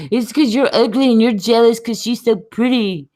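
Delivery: mocking imitation. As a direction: mimic a jeering critic. Mimics Twitter critics taunting her.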